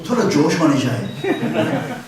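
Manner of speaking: complaining uncle. Retold or imitated. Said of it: "Some uncle G's came to me and said".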